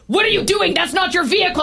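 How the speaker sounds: high, pushy accusing call; accusing stranger. Retold or imitated. Driver pulling up challenges her, high and accusing. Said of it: "they're like".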